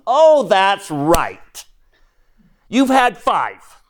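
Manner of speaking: a hint of a wry, all-knowing Jesus. Jesus voiced revealing her past.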